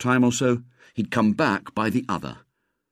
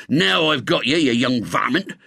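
raised, angry farmer's cry; shout; 4 semitones higher, 3 dB louder than usual. A narrator's reading. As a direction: pounce on the trespasser with a cry. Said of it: "he heard a shout".

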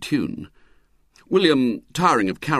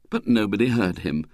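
plain reading voice

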